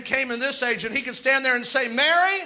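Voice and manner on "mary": higher-pitched call, lifted above the talk; calling out a name. Acted. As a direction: call to her by name from the pulpit. Calls Mary by name as prophet.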